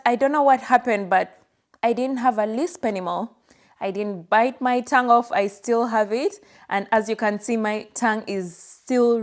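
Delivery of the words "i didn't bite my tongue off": usual speaking voice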